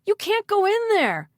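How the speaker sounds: high, loud warning cry; alarmed shout. A narrator's reading. Eric warns the mayor off the cell, shouted. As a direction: shout it high to stop him. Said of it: "Eric shouted".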